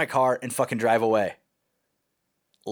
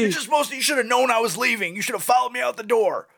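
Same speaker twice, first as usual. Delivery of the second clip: high, whining complaint; whiny blame; 8 semitones higher than usual. Retold or imitated. Young man whining at girlfriend about leaving, petulant. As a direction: sulk and blame her like a brat. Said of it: "I'm like".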